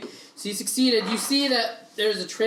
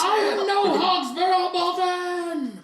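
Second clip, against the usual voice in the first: high, slow, drawn-out yell; indignant halfling; 13 semitones higher, 5 dB louder, slower than usual. Acted. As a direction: wail back in protest as the fleeing halfling. Game master yells back as runaway halfling.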